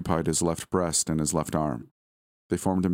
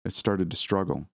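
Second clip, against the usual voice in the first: ordinary delivery, nothing marked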